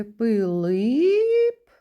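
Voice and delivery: second И of "Пилип" held long and loud; "Пилип" with a long, rising final И; fourth sound stretched. Shown as a demonstration. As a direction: stretch the last vowel of "Пилип". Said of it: "Наступний звук у слові".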